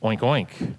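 low, falling oinks after a pause; two reluctant, spoken oinks; pig oinks. Acted. Pig hearing of ham breakfast, reluctant oinking. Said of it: "And the pig goes".

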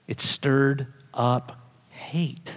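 slow, breathy whispered line. Acted. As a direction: hush it almost to nothing, slowly. Preacher repeats line, whispered first.

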